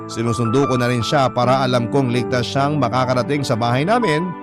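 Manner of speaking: ordinary talk, nothing marked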